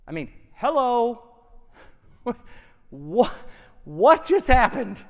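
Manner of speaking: stammer, then ask in amazement; playfully taken aback; mock disbelief. Preacher acts startled at the verse.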